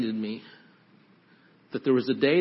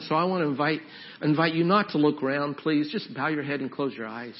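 normal talking voice

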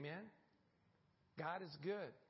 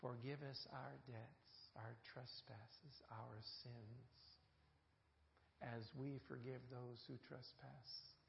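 much softer, slow and reverent; hushed prayer; 6 semitones lower, 10 dB quieter, slower than usual. Acted. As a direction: let the prayer sink to near silence. Reciting the Lord's Prayer.

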